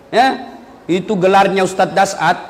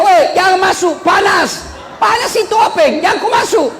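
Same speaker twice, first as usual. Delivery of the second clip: mock yell; 10 semitones higher than usual. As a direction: shout a panicked warning at the stranger. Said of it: "dia teriak". (teriakan peringatan pura-pura dengan nada tinggi)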